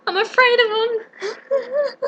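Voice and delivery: very high, slow sobbing wail; bawling. Acted. Lion admitting he fears sheep, bawling high and slow. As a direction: break down wailing. Lion confesses fear, then cries.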